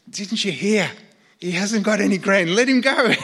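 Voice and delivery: put-on high, loud child's voice bossing the crowd; a small boy with hands on hips telling grown-ups off; three-year-old boy in Hausa. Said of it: "he says in perfect Hausa".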